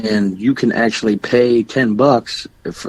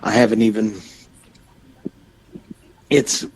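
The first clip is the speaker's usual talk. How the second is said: talking through hiccups; words cut by hiccups. Real, not acted. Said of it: "sorry, I got the hiccups".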